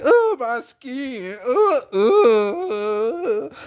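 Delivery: very high, wailing cry over her skin; hammed-up sobbing wail; mock wailing. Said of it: "crying about her beautiful skin".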